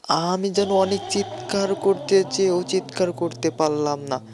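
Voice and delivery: plain reading voice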